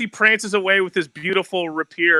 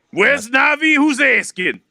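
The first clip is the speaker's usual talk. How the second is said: high, loud suspicious challenge; hammed-up demand to know who wants them; put-on character voice; 10 semitones higher, 6 dB louder than usual. Sudden bit of character voices.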